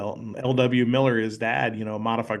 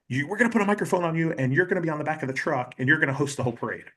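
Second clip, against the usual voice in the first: fast, raised-pitch imitation of bosses; rushed orders; 5 semitones higher, much faster than usual. Bosses assigning him the parade an hour before, rushed orders. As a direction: rattle off last-minute instructions.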